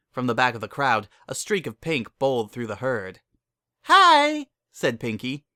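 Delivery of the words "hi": high squeaky bubbly pony; Pinkie's voice. Acted. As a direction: burst in with a high chirpy hello.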